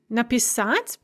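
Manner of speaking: Написать with the stress on its last syllable; stress on final syllable. Shown as a demonstration. Teacher showing where stress starts, final syllable stressed in Написать. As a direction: land the stress of Написать on -сать. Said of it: "the stress shifts to the front".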